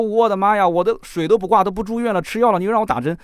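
loud, high protesting outcry; exasperated disbelief. Retold or imitated. Patient protests to doctor friend, loud and exasperated. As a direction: throw up your hands in disbelief. Friend suggests injections after all.

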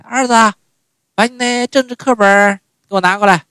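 high, loud mom calling for the textbook; mother calling. Retold or imitated. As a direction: call out to her son, stressing 政治.

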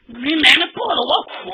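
ordinary delivery, nothing marked

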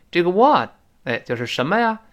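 normal talking voice

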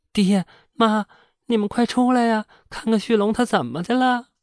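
high, loud calling for help; panicked shout. A narrator's reading. Wife calling her in-laws through the window, high panicked shout. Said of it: "扒东屋的窗户就喊".

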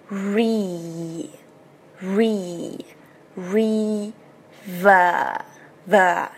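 "re" as a long "ree", lips pulled wide; drawn-out model syllables "re" and "ver"; spread-lip vowel. Shown as a demonstration. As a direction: stretch each syllable of "river" very slowly. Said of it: "变成两个嘴角向两边咧开".

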